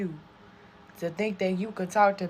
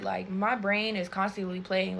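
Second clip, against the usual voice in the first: usual speaking voice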